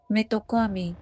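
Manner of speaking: ordinary delivery, nothing marked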